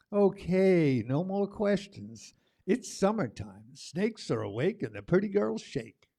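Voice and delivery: rhythmic, punchy spoken word read; spoken word poem. Acted. Poet performing the summer poem for the crowd, rhythmic and bold. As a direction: ride the beat of summer.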